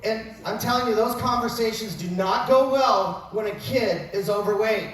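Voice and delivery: normal talking voice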